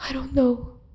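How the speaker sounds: small, high, tearful and soft; crying. Acted. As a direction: whimper it out. Julia crying.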